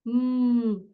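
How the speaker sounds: "Hum" as one long, steady closed-lip M sound; fonema do M. Shown as a demonstration. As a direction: hold the M sound, humming it. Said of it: "Qual que é o fonema da letra M?". (fonema M prolongado, "Hum")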